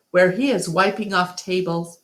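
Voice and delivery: ordinary delivery, nothing marked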